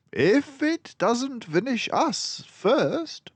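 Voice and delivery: high, slow, dry joke; grim humour with a twinkle; wry Basil. A narrator's reading. Basil joking darkly to Dawson, high and slow.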